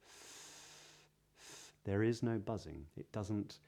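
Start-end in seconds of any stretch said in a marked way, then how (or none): 0.0-1.7 "th" as pure air, no buzz; voiceless TH. Shown as a demonstration. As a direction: breathe out the "th". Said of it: "voiceless T H".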